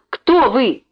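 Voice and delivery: insist, raising the voice; irritated repeat for someone who did not hear. A narrator's reading. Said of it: "сердито перебил её".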